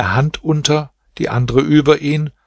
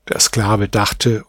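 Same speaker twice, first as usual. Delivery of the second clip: ordinary delivery, nothing marked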